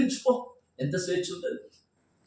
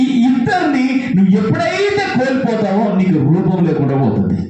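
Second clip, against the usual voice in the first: fiery preaching; 8 dB louder than usual. Acted. Preacher rousing the congregation about being used, fiery preaching. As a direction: preach it out to the back rows.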